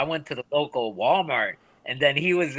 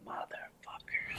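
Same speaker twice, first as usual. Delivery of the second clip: slow, breath-only swearing; whispered curse; 16 dB quieter, slower than usual. Retold or imitated. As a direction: curse under your breath, drawn out. Retold muttered curse when outnumbered.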